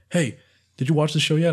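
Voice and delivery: high, loud excited sister imitation; bursting in, loud and high; 1 sister's eager question. Sister kicking door down, loud eager question.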